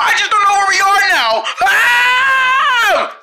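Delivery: panicked wail. Acted. Lost child panicking to the group, wailing.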